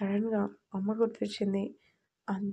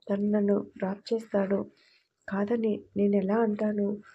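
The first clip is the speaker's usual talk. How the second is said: plain reading voice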